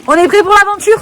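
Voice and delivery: loud high call; rally everyone for the adventure; rallying shout. Real, not acted. Calling out, rallying. (cri d'enthousiasme)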